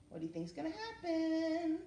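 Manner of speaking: singing the question to little listeners; sing-song question. Acted. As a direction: tease the answer musically. Asking children mid-story.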